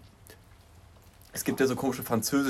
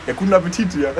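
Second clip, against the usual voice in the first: amused, raised shout from the stands; retold heckle; 7 semitones higher, 9 dB louder than usual. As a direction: call it out higher and louder, half laughing. Retold heckle in empty stadium.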